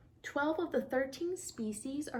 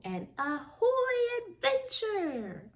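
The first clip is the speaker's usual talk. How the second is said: higher, slow proclaimed motto; grand motto; 10 semitones higher, 4 dB louder, slower than usual. Acted. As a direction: announce it like a rallying cry. Otter recites captain's motto.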